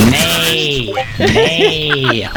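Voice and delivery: horse neighing. Pretend. Man going to feed his horse, mock neighing. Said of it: "time to go feed my horse".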